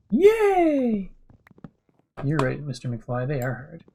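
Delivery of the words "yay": high squealed yay; excited cheer. Real, not acted. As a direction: cheer it out, delighted. Celebrating getting words out.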